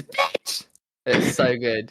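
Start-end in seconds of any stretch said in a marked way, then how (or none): 0.0-0.7 imitated scream. Retelling the singer's repeated scream, high mock squeal. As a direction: squeak out a contained stage scream. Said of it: "She then just screams".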